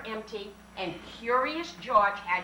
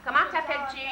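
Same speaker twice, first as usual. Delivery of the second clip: louder prompt; 3 semitones higher, 4 dB louder, much faster than usual. Shown as a demonstration. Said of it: "teacher prompting child".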